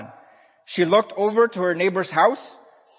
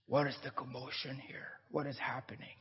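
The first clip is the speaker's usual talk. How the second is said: put-on soft, much quieter old man asking in wonder; frail old man; 3 semitones lower, 17 dB quieter than usual. Old man hobbling into court.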